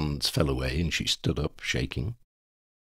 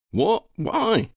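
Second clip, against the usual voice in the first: shocked; 4 dB louder than usual. Acted. Ron shocked by kiss suggestion.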